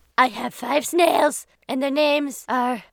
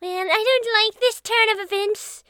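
higher put-on funny voice; 7 semitones higher than usual. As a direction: talk in a silly high voice. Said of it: "whoa, my voice".

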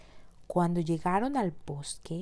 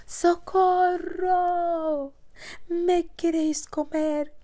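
high, slow squealing piglet cry; 11 semitones higher, 7 dB louder, slower than usual. Acted. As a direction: scream for help like a piglet. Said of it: "gritó el cerdito".

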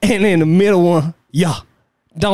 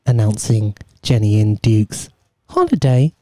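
airport announcement; 6 semitones lower than usual. Pretend. Mock airport announcement for holiday.

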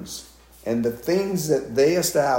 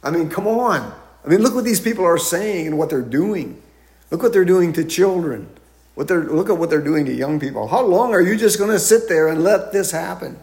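exasperated plea to God; 6 semitones higher, 5 dB louder than usual. Retold or imitated. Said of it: "I think".

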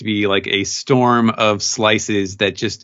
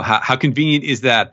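normal talking voice

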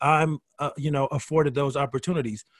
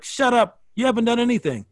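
mock scornful brush-off, pitched up; dismissive put-down; 7 semitones higher than usual. New York comic shutting down a nobody, mock scornful and pitched up. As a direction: toss it off like a bored veteran comic. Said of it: "Quinn will be like".

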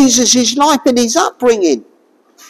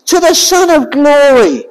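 crowd's hosanna; 7 semitones higher than usual. Retold or imitated. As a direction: call it out like a crowd welcoming the king. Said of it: "And they were singing".

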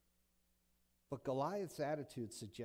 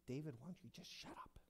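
whisper; 11 dB quieter than usual. Retold or imitated. Brothers hushing David.

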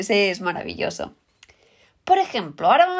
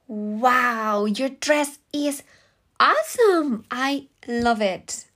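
excited compliment; 3 semitones higher, much slower than usual. Acted. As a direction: gush over a friend's dress. Acted compliment on a dress.

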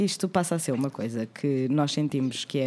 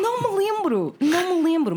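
much higher, mocking repeat; mock high echo; 13 semitones higher than usual. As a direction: echo it back in a silly high voice.

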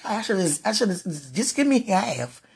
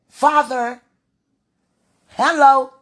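calling out; 6 semitones higher, 7 dB louder, much slower than usual. Real, not acted. Calling out to God.

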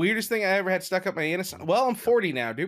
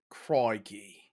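low, softer British attempt that sounds Australian; botched British accent; 10 semitones lower, 5 dB quieter than usual. Co-host asked to do British, botched low Australian-sounding accent. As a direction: try British and land on mock Australian. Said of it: "Let me hear your British".

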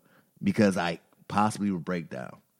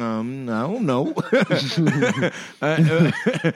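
sheepish laugh; 5 semitones higher, 6 dB louder than usual. Retold or imitated. Sheepish reply to mom.

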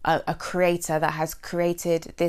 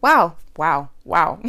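overwhelmed with emotion; 2 semitones lower, 6 dB louder, slower than usual. Real, not acted. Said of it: "I feel really emotional talking about this".

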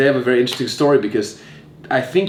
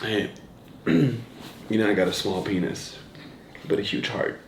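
cheesy pickup line; 3 semitones lower, 10 dB quieter, slower than usual. Pretend. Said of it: "My pickup line often is like".

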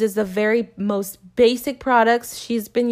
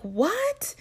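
stunned; 11 semitones higher, 7 dB quieter than usual. Retold or imitated. Shocked by boyfriend wanting six kids.

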